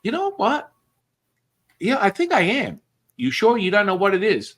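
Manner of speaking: lightly voiced high puzzled victim; puzzled dupe. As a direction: play the confused mark feeling for something missing. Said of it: "And they're like".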